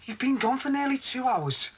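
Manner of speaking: high-pitched, reproachful complaint; accusing. Acted. Man confronts late-returning Michelle, accusing. Quarrel over her lateness.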